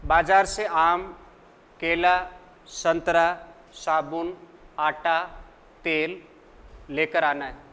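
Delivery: a short pause after each item, आम, केला, संतरा, साबुन, आटा, तेल; comma pauses in a list. Shown as a demonstration. Teacher dictating a shopping list to students, pausing after each item. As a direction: separate each item from आम to तेल with a small break. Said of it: "प्रत्यय के बाद कौन सा चिन्ह लगाओगे".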